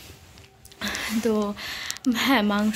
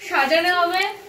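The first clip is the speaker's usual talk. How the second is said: excited and laughing; 8 semitones higher than usual. Real, not acted. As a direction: burst out with glee now the secret's out. Surprise revealed, teasing mother. (হাসতে হাসতে উচ্ছ্বসিত গলায়)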